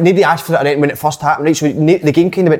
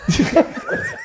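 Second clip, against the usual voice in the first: high laugh; 6 dB quieter than usual. Real, not acted. Burst of laughter in banter.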